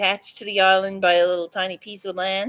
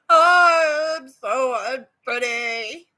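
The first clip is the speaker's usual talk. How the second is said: mock self-pity; 4 semitones higher, 5 dB louder, much slower than usual. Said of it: "Or I can sit here and say".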